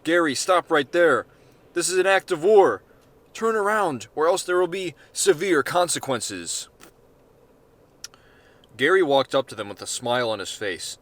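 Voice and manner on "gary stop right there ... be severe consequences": loud, high commands through a megaphone; megaphone shout. Acted. Agent through a megaphone orders Gary to stop. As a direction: bark it like a bullhorn order. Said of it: "One of them had a megaphone".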